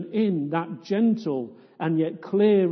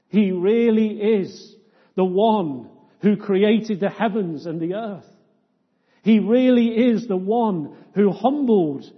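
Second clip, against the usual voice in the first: full-force preaching; 3 semitones higher, 7 dB louder than usual. Real, not acted. Preacher proclaiming Christ's glory to the congregation, fervent. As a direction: declare it to the whole church, building.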